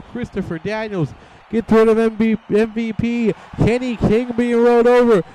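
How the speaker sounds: high, loud climax call; excited finish call. Real, not acted. Commentator calling the match's winning pinfall, excited. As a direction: build to the winning moment.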